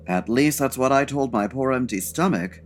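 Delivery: ordinary talk, nothing marked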